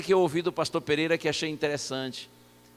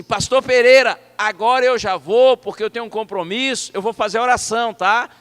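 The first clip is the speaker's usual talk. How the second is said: loud, higher voice for deaf ears; high-volume talk; 5 semitones higher, 11 dB louder than usual. Retold or imitated. Visitor telling a near-deaf pastor he must leave, high-volume talk. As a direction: shout each phrase so he hears. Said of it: "de alto volume".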